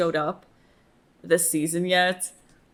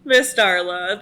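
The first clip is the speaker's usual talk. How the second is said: loud, higher, breathless fan gushing; excited gushing; 7 semitones higher, 6 dB louder than usual. Real, not acted. Fan thrilled Darla is back, loud gushing. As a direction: bubble over with excitement. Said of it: "I am so excited".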